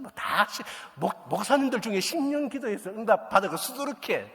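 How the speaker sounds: high, loud preaching voice; preacher in full flow; fiery preaching. Acted. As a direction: drive the point home to the congregation. Preaching about years of prayer.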